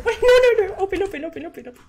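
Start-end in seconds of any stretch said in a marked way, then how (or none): none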